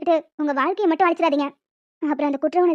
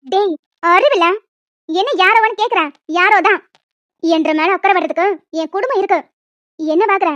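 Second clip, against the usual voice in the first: louder, biting scolding held back through clenched teeth; angry through gritted teeth; 5 dB louder than usual. Acted. Married woman scolds her old classmate for his confession, angry through gritted teeth. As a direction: snap at him but keep it contained in public. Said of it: "பல்ல கடிச்சிட்டு பேசி நிக்கிறேன்".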